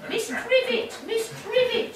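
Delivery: high-pitched imitation of a woman calling; ghostly caller. Fanny's ghost calling Miss Trivett to give a tip, high imitation. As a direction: put on a high voice to get attention. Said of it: "mouthing something to me".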